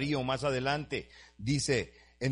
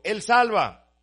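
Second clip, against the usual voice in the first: loud, higher, slowing climax; full-force preaching; 5 semitones higher, 9 dB louder than usual. Acted. Preacher shouts God's deeds to the congregation, loud and high. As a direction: build each word into a shout of praise.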